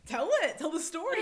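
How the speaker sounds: high, loud excited demand; eager urging. Retold or imitated. Child urging mum to tell the story, eager shout. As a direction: egg them on loudly.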